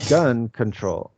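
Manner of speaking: British-style gun control with the weight on gun; stress on GUN. Shown as a demonstration. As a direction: lean on gun, let control drop. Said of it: "he put the stress on the gun".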